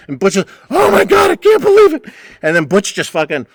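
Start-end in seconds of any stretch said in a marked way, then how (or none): 0.7-2.0 much higher, louder mock squeal; hammed-up indignant squeal; shrieking old man disbelief. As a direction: wail it like an outraged old man. Butch storms out.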